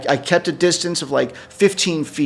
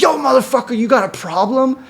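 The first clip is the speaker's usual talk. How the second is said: high-pitched mock street challenge; imitating streetwise yelling; 10 semitones higher than usual. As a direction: imitate her picking a fight with a stranger, pitched well up.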